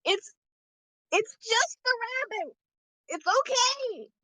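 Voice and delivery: put-on high-pitched little kid voice; little child. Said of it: "you see this little child".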